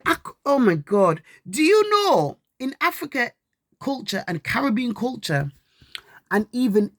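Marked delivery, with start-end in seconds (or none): none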